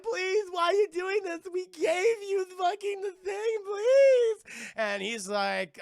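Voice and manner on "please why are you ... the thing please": high, loud tearful wail; acted crying shout, drawn out; panicked pleading. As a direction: beg in hammed-up hysterics. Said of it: "still fucking panicking".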